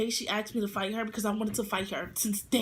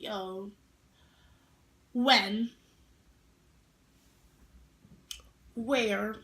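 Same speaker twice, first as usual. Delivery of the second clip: challenging; 2 semitones lower, 4 dB louder, slower than usual. Retold or imitated. Challenging girl to fight.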